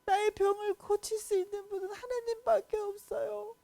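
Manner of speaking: king sobbing a prayer to God; crying prayer. Acted. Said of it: "엉엉 울면서".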